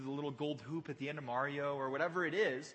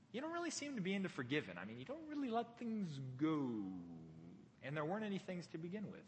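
much softer, hurried mock reproach; quiet aside; 8 dB quieter, faster than usual. David points out Saul's grudges to him, quiet aside. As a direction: drop the volume and needle him gently.

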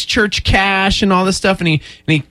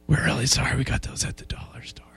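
meek whisper; 4 semitones lower, 12 dB quieter than usual. Retold or imitated. Meek apology from store staff.

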